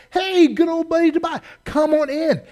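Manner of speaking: hammed-up friendly welcome; mock buddy greeting. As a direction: play the overly friendly host. Tobiah's welcome in mock scene.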